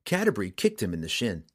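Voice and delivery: ordinary delivery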